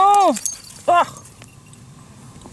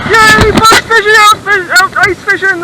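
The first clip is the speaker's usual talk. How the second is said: high, loud, giddy celebration; elated, raised voice; cheering; 4 semitones higher, 5 dB louder than usual. Real, not acted. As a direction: burst out celebrating. Cheering the catch.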